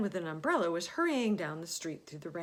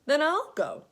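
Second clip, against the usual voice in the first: higher and much louder, eager; bold offer; 9 semitones higher, 10 dB louder than usual. Acted. Alexander offering to cross the river, eager and loud. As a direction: jump in, keen to help.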